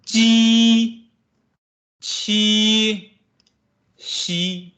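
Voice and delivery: ji, qi, xi as clear model syllables; teacher modeling syllables; Mandarin ji qi xi. Shown as a demonstration. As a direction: say ji, qi, xi cleanly. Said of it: "And they themselves read out as".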